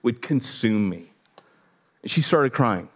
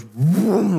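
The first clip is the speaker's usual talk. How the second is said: higher-pitched engine zoom; imitated car vroom; 5 semitones higher than usual. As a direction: imitate a car zooming right past. Imitates the car zooming past.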